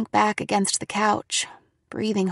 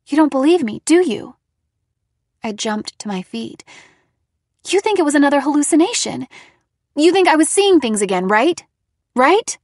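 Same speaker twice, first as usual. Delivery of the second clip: louder, higher, rushing accusation; hurt and furious, fast; angry accusation; 5 semitones higher, 7 dB louder, faster than usual. A narrator's reading. Daughter accuses mother, angry.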